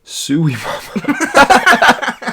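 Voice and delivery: very drawn-out, high mock cry; hammed-up delight. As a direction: stretch it out high in pretend rapture. Acts out own delighted reaction.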